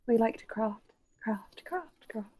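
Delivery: soft eerie chorus whisper. Acted. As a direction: breathe the words like spirits.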